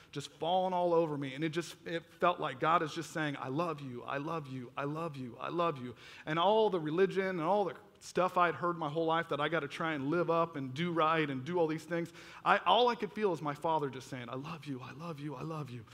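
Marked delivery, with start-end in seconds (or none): 3.4-5.9 soft, lower, repeated gently; tender reassurance. Retold or imitated. God speaking to the teen under the waterfall, tender reassurance. God's repeated words of love. 14.3-15.8 hushed, much softer repetition; tender reassurance. Retold or imitated. Heavenly Father to son weary of religion, tender reassurance.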